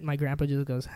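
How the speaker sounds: usual speaking voice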